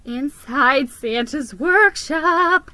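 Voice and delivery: loud, slow title announcement; slow stately heading; chapter title. A narrator's reading.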